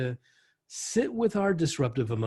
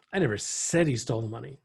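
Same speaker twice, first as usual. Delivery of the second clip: "said" picked out with emphasis, the rest unstressed; stress on SAID; 2 semitones lower than usual. Shown as a demonstration. Trainer showing how inflection changes meaning, stressing "said". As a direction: hit the word "said" and let the rest fall back. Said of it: "the inflection of your voice".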